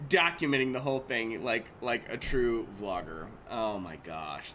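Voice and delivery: usual speaking voice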